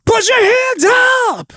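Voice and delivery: very high rock scream; shouted command. Shown as a demonstration. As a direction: scream it out to the whole crowd. Demo of shouted crowd command.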